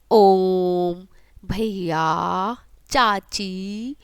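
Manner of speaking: very drawn-out ओ in ओम, आ in भैया, ई in चाची; triple-length vowels. Shown as a demonstration. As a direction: stretch ओम, भैया, चाची like a sustained call, three beats on the long vowel. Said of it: "ह्रस्व स्वर से तिगुना time लगता है". (ओम, भैया, चाची में स्वर को तिगुना खींचकर)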